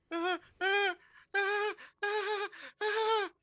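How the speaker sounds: high-pitched squeals of alarm; panicked high squeaks; squealing panic. Acted. Sundae man squealing as the bear slams in.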